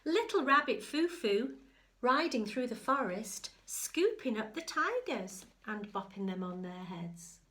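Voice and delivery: sing-song picture-book telling; storybook narration. Acted. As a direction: build the excitement for a child.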